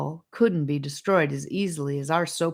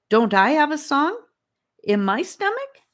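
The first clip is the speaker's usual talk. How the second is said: eager question; 11 semitones higher, 5 dB louder than usual. A narrator's reading.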